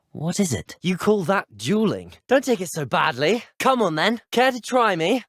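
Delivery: high, cocky challenge, building; taunting. Acted. Duellist daring an opponent to try, taunting. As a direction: goad your opponent into attacking.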